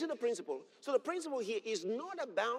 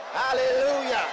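a big, loud shout of praise; shouted hallelujah; 5 semitones higher, 12 dB louder than usual. Real, not acted. Preacher praises God before the congregation, loud exultant shout. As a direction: cry it out in triumph.

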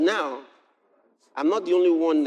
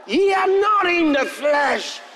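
preaching at full force; 11 semitones higher, 5 dB louder than usual. Acted. Preacher proclaiming scripture to the congregation, shouting. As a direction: thunder the verse at them.

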